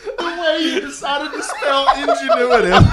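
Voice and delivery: high, loud, laughing through tears; can barely speak for laughing; laughing hard. Real, not acted.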